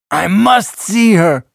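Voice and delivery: high, loud, impassioned burst; urgent exclamation. A narrator's reading. Salva demands to see his mother, urgent.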